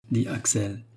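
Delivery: ordinary delivery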